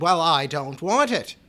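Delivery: flatly refuse, raising the voice hard; loud refusal. Retold or imitated. Mother refusing the son's casket choice, loud and insistent.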